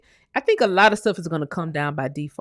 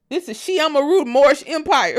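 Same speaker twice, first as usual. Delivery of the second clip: ordinary delivery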